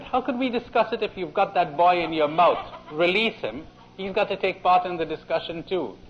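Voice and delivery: a clever rabbit with a laugh in it. Retold or imitated. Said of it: "And the rabbit said".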